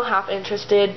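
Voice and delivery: plain reading voice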